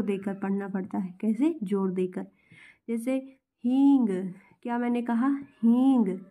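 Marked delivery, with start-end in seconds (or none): 3.6-4.1 "हींग" with a loud, pressed first syllable; stress on hee. Shown as a demonstration. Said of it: "ही में ज़्यादा मुझे जोर देना पड़ रहा है ना". 5.6-6.2 "हींग" with a loud, pressed first syllable; stress on hee. Shown as a demonstration. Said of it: "ही में ज़्यादा मुझे जोर देना पड़ रहा है ना".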